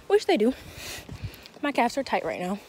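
ordinary delivery

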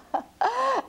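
a pleased giggle after the thank you; high laugh. Acted. Una laughs after thanking giant.

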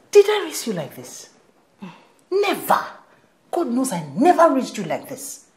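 high, emphatic maternal reproof; indignant scolding. Acted. Mother appalled at daughter's views on marriage, indignant.

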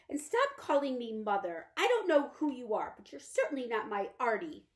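rejecting a stranger in her room; cold, confused mother. Acted. Mother no longer knows her son.

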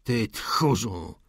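slow, drawn-out contempt; restrained shout of contempt; scornful cry. A narrator's reading. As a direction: throw the insult slowly, with scorn. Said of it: "Krzyknął nań z pogardą".

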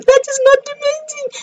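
very high, rushed interjection; excited squeal. Real, not acted. Streamer hurrying past the timing, excited high squeal. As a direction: squeal it out fast. Brushing detail aside in excitement.

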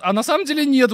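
mock video host. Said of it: "он щас скажет".

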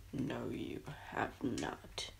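1 whispered denial. Acted.

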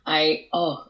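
ordinary talk, nothing marked

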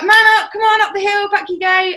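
high, loud bossy stepdad barking orders; mock coach yell. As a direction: shout him up the hill like a drill coach. Stepdad yelling during training.

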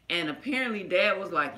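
normal talking voice

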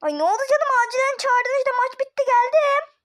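loud, high put-on concern; feigned innocence. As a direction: play the innocent late arrival, loudly. Prankster feigns innocent arrival. (yapmacık, tiz ve yüksek sesle masumiyet)

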